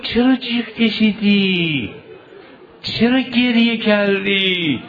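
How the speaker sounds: high, slow, drawn-out plea; elegy chanted high and slowly; mourning lament. Acted. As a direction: stretch each phrase into a grieving wail. Eulogist voices a quote in lament.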